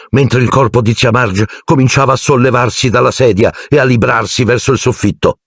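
ordinary delivery

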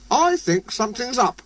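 high little engine voice; Percy voice. A narrator's reading. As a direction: pitch up for Percy. Percy speaks.

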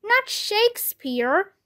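high, slow wail of protest; storybook cry of dismay; shouting. A narrator's reading. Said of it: "I shouted".

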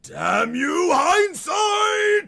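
rising, melodramatic cry; dramatic curse. Acted. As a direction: shout climbing very high. Cursing his mistake.

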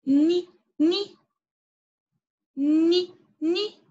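"ني" as a short, loud "ni", repeated; nun with kasra. Shown as a demonstration. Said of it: "نون مع الكسرة". ("ني" بنون مكسورة، بصوت عالٍ)

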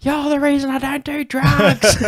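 high, drawn-out yell out a window; imitated yell. Buddy driving past the van, imitated high yelling. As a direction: yell it like a passerby calling out. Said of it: "he's just yelling out the window".

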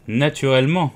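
ordinary delivery, nothing marked

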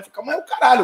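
high, heated dismissal; retold angry retort. Answering his father who told him to calm down, angry. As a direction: brush off the calming word.

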